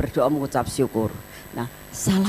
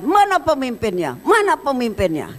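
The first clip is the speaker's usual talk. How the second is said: a high, raised imitation of a shouting protester; mock yell; 8 semitones higher than usual. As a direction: voice the angry protester demanding the leader. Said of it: "Teriak-teriak dan dia mengatakan".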